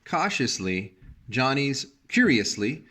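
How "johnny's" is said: usual speaking voice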